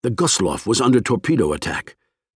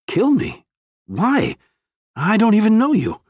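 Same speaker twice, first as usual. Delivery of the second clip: high, stunned and disbelieving; shocked disbelief; 13 semitones higher than usual. Acted. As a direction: cry out in disbelief at the threat. Professor faces a pistol.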